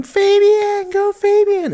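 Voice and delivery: very high, squealing cheer; mock scream. Cousin cheering for Fabian from the crowd, squealing high. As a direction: shriek the name like an excited fan girl. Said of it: "just scream".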